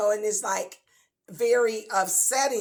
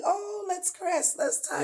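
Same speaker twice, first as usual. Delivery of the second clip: high-pitched mock delight; playfully gushing, pitch way up; acted happiness; 11 semitones higher than usual. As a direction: coo it high, hammed-up lovey-dovey. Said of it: "When you're happy".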